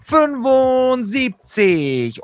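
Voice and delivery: high, slow, drawn-out ring announcement; brash fight promoter hype. Acted. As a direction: stretch the number like a ring announcer. Ring announcer style episode number. (gedehnte Ringsprecher-Ansage, hoch)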